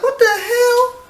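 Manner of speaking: very high, drawn-out incredulous squeak; slow, squeaky mock bafflement; mock disbelief. Voicing manager looking worker up and down, mock incredulous.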